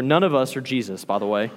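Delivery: ordinary talk, nothing marked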